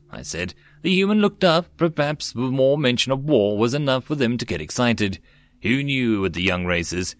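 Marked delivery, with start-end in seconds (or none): none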